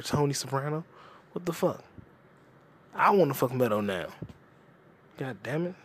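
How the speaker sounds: ordinary talk, nothing marked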